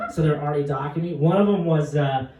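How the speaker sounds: ordinary delivery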